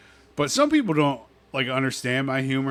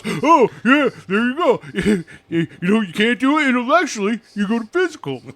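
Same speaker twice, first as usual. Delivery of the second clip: loud, high-pitched imitation of a needling buddy; hammed-up jeering in a bickering match; mock taunting; 9 semitones higher, 9 dB louder than usual. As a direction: goad him, voice pushed up and laughing.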